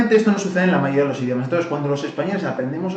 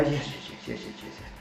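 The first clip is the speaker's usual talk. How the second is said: mumbled yes; 2 semitones lower, 15 dB quieter than usual. Retold or imitated. Nodding along without understanding English.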